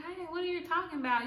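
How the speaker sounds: high, quick, innocent playing-dumb voice; sweet innocent roommate. Roommate denying taking the deodorant, high sweet innocent voice. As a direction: sound sweet and clueless, up high and fast. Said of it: "she was a sweet girl".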